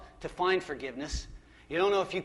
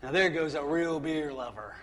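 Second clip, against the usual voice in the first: 1 announcer tagline. Retold or imitated. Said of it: "you can say them with me".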